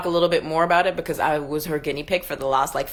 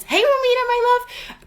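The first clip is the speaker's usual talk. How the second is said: high, bright call to a viewer who joined; sudden lift in pitch to greet a friend; happy hello to a viewer on the live; excited greeting. Real, not acted.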